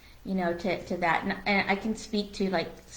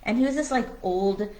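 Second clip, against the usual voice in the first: ordinary talk, nothing marked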